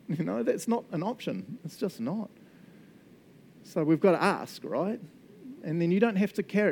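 usual speaking voice

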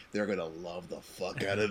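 low, soft villain purr; lightly voiced evil mastermind; Doctor Evil voice. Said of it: "like, Doctor Evil".

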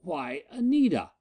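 utterly thrown by the sight; astonished. Acted. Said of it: "all his calm triumph swept away".

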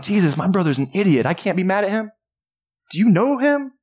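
comic protest climbing high in pitch; mock exasperation, ending on a high incredulous question; exasperated crowd member. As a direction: object like an aggrieved brother, rising to disbelief. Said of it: "imagine what that crowd is feeling".

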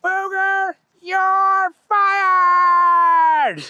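high, very loud furious yell; angry high boss shout. A narrator's reading. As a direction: bellow the firing out the window. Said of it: "he shouted out".

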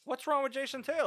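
can't believe that pick; incredulous. Real, not acted. Arguing over a player pick.

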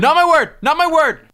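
high, loud repeated objection; loud protest. Pretend. Host rejecting friends' guess at his word, high yelling. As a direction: cut them off with a high yell.

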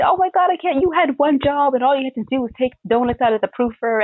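high exasperated scolding; exasperated yelling. Retold or imitated. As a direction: scold him, fed up and frantic. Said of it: "letting off the F-bombs".

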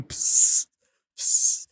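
short hissed psst spurts; imitated pee squirts. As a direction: squirts like suppressed laughter. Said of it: "his piss is holding back laughter".

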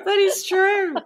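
squealing with laughter; laughing it out, high. Real, not acted. Still laughing.

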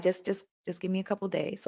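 soft reassurance. Retold or imitated. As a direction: hush it, calm and patient. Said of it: "I got, like".

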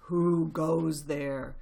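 slow, loud challenge from above; deep voice. A narrator's reading. Deep voice above the foxes' heads challenges them, slow and commanding. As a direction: draw it out slowly and forcefully. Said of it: "a deep voice above their heads said".

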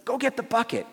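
high-pitched imitation of excited teen girls; teenage daughters. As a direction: pipe up like an eager teenage daughter. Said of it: "their two teenage daughters say".

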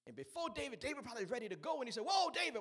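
fast, loud, rising whoop; preacher's shout. Acted. Preacher shouting God's call to David. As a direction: burst out as God stops David.

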